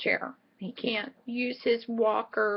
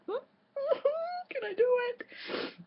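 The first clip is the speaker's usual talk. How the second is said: laughing; 5 dB quieter, much slower than usual. Real, not acted. Laughing at sitting on hands.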